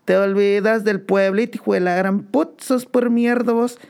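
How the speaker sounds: very high, hammed-up angry scolding; shrill mock Guatemalan scolding; mocking Guatemalan shout. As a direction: screech the insults like an outraged countrywoman. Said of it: "le dice".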